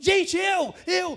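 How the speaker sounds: put-on high, loud child trying to get a word in; a kid squeezing into the talk; mock kid cutting in. Boy trying to interrupt his chatty parents.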